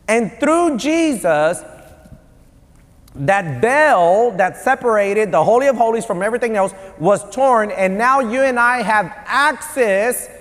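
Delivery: high, drawn-out preacher delivery; emphatic preaching. Real, not acted. Preaches the point with force.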